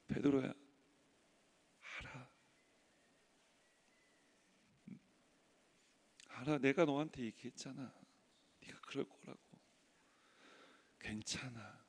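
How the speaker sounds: soft, near-whispered reassurance; tender comfort. Acted. Jesus tenderly comforting Peter after the denial, soft. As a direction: hold him gently with your voice.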